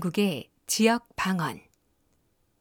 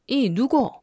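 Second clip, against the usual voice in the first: higher, louder rough Gyeongsang lilt; Gyeongsang dialect; 4 semitones higher, 4 dB louder, slower than usual. Shown as a demonstration. Narrator showing the class Gyeongsang speech, rough Gyeongsang accent. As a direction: punch it out in a blunt Gyeongsang accent. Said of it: "경상도 방언의 거친 매력".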